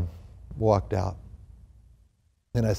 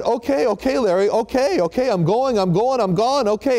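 flustered retreat; 10 semitones higher than usual. Retold or imitated. The visitor backing out of a yelling neighbor's room, flustered. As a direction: talk the shouting man down while backing out.

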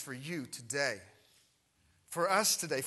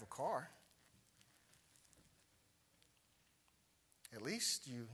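gentle inner voice. Retold or imitated. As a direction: let each at least land gently. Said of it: "this one thought comes in".

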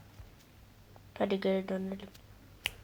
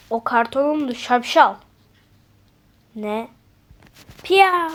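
normal talking voice

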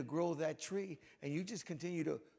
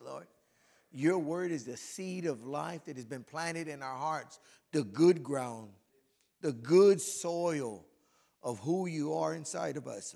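louder, fervent prayer to God; 6 dB louder than usual. Acted. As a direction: pray over the men with building fervor. Leads a closing prayer.

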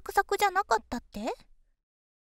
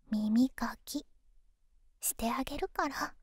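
whispering; 2 semitones lower than usual. Acted. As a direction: close breathy whisper. Offering ear cleaning, whispered.

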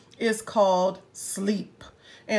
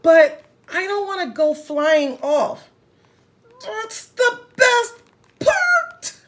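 rising high-pitched delight; thrilled squeal; 7 semitones higher, 6 dB louder than usual. Acted. Hippo delighting in flying off the swing, high squeal. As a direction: squeal at the scary fun part.

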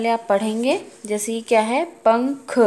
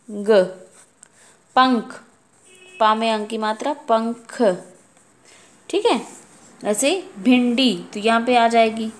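ordinary talk

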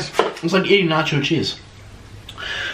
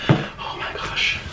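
whisper; 6 dB quieter, much slower than usual. Real, not acted. Whispered after bite.

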